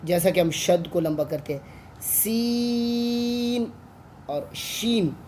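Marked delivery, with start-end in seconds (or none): 2.0-3.7 सीन held very long on the ee; long drawn-out seen. Shown as a demonstration. Teacher demonstrating the long सीन to pupils. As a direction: stretch सीन slowly and evenly. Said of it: "जिन्हें हम लंबा करके पढ़ते हैं".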